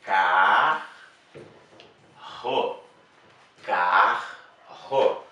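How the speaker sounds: careful classroom syllable split; syllable demo. Shown as a demonstration. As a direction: say carro slowly, split into car and ro. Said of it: "Vamos separar as sílabas".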